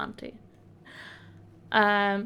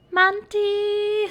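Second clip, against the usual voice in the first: high, loud happy call of a name; excited shout-out; 10 semitones higher, 5 dB louder than usual. Real, not acted. Greets a subscriber excitedly.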